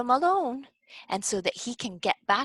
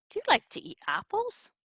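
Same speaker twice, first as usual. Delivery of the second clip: ordinary talk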